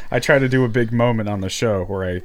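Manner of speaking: ordinary delivery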